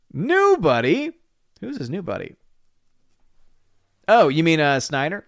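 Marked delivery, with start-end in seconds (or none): 0.1-1.1 high, slow, disbelieving New buddy?; hammed-up jealous New buddy?; mock jealous shock. 1.6-2.3 hushed suspicion. Acted. Drops to suspicion.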